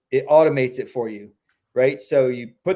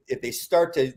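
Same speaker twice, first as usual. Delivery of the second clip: ordinary delivery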